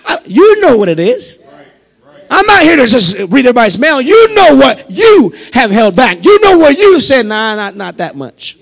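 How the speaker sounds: loud, high full-force preaching; preaching climax. Real, not acted. Pastor urging his church to commit everything, loud preaching. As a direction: drive it home with fervour.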